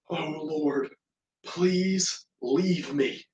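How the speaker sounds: hushed plea after a sharp gasp; gasp, then plead softly and slowly; retold panic. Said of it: "Simon's heart starts to panic".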